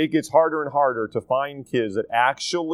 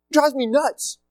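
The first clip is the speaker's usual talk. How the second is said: much higher, fed-up repeat; exasperated again, pitched way up; 10 semitones higher than usual. Shown as a demonstration. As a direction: throw it up higher the second time.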